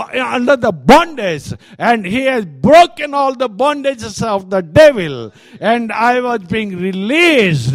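loud, fervent first-person testimony from the pulpit; booming revival-style preaching; impassioned preaching as the healed man. Acted. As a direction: preach it as the man freed from demons, full force.

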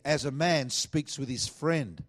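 measured repeated verse; scripture reading. Acted. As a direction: lean into the verse slowly.

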